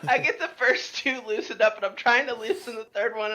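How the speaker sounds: ordinary delivery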